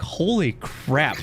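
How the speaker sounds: slow, drawn-out astonishment; amazed. Retold or imitated. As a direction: stretch it out in awe. Imitating amazed viewer reaction.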